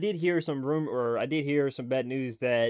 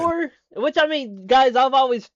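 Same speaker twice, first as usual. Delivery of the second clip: high, loud, thrilled rush; real fan excitement at full volume; excited outburst; 12 semitones higher, 11 dB louder than usual. Real, not acted. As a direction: blurt it out, still floored. Excited at Thor's appearance.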